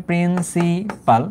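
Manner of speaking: Principal drawn out slowly, syllable by syllable; dictation pace. Shown as a demonstration. Teacher explaining what P stands for, principal slowly drawn out. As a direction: stretch each syllable of principal for note-takers. Teacher repeats term for writing down.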